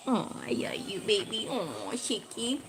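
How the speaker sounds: soft, nearly whispered I love you; tender murmur. Real, not acted. Murmuring sweetly to Shiki off to the side. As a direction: hush it like a private sweet nothing. Soft loving aside to someone.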